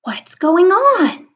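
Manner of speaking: slow, high puzzled question; puzzled woman. Acted. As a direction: ask it slowly, high and curious. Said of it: "She says".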